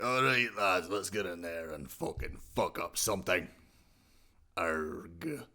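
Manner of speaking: a lightly voiced angry pirate captain; rough, gruff pirate rallying his crew, a hint of anger; Blackbeard pirate. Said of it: "if Mister Krabs had a real bad day".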